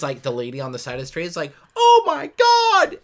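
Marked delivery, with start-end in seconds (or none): 1.7-3.0 very high, loud, drawn-out mock scream; mock horrified scream. Film fan plays the lady witnessing it, mock horrified scream. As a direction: wail it like an over-the-top horror witness.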